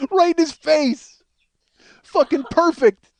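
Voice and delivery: high, loud, slow, laughing through the words; real laughter bursting through. Real, not acted. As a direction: still cracking up, push it out high and loud. Cracking up at own story.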